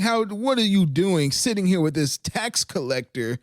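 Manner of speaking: high whiny mock accuser; high, indignant mock critic voice; mocking commenters. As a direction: squeak out a nagging complaint. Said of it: "people were like".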